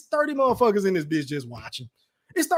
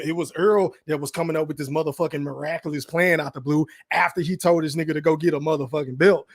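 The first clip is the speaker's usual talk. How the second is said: ordinary talk, nothing marked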